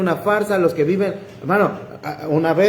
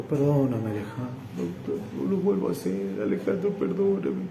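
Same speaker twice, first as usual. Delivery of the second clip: imitated tearful pleading; 5 semitones lower, 8 dB quieter than usual. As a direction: imitate a man begging forgiveness through tears. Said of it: "Él terminó llorando". (súplica llorosa imitada, en voz baja)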